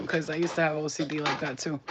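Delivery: ordinary delivery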